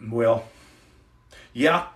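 ordinary talk, nothing marked

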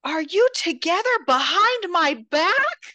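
high, loud, slow accusing wail; mock hysterical sister. Podcaster voicing the sister confronting the husband, high wail. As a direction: play the betrayed sister over the top. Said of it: "She's like".

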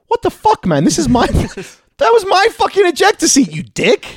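high, loud mock outrage; outraged pilot. Pilot yelling at the hero over his ejector seat, high mock outrage. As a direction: shriek at him for stealing the seat. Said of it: "the pilot was like".

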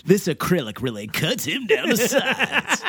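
slow, laughing trailer narrator. Acted. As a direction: announce it like a trailer. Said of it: "goes back to the trailer voice".

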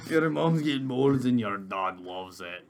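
slow put-on Scottish accent; mock Scottish accent. Friend echoing a silly insult, slow mock Scottish accent. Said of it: "language? Like, like from where?".

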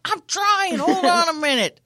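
high, loud, hammed-up exasperation; flustered protest. Acted slow elderly driver protesting.

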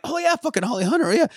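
high, fast delighted exclamation; excited recognition. Retold or imitated. A fan spotting Holly Hunter on screen, high and delighted. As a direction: blurt it out high in happy recognition. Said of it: "and I'm like".

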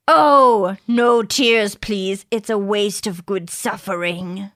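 a second try at a badly faked Irish accent; another bad accent. Friend retrying a movie quote for a guessing game, mock Irish accent. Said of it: "I'll do another one".

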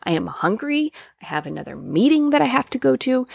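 imitating your own irritated outburst; annoyed. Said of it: "because I was annoyed".

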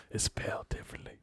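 breathy secret whisper. Shown as a demonstration. Joking to friends about the spelling, whispered. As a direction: whisper it like a confidential aside. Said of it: "They, like, whispers it".

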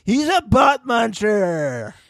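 lightly voiced schoolkid; mock teenage taunt. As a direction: tease like a twelve-year-old. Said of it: "like, twelve or thirteen".

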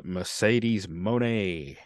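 Mercedes Moné stretched out very slowly; "Moné" with a long, held final "ay"; drawn-out name announcement. Shown as a demonstration. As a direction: linger grandly on "Mercedes Moné", drawing out each word. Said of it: "have to say it like that".

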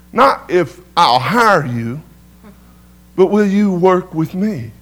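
slow, weighed contrast of hire and work; slow emphatic. Retold or imitated. Shop owner urging Tony, slow emphatic offer. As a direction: press each word slowly.

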